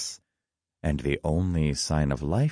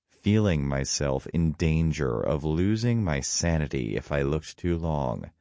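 plain reading voice

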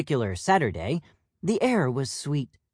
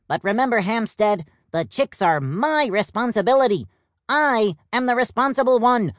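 cheery peacock; 7 semitones higher, 4 dB louder, faster than usual. Acted. Plum insists he is responsible.

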